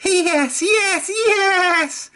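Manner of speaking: very high, squealed gleeful yeses; giggling trucks. A narrator's reading. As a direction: cheer the plan on. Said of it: "giggled the trucks".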